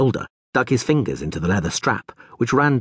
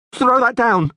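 much higher, loud, panicky yell; trembling yell; 5 dB louder than usual. Acted. Frightened guard orders the Rivian, trembling yell. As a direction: yell it, voice shaking with fear. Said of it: "yelled with a trembling voice".

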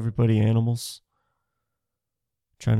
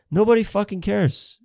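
ordinary talk, nothing marked

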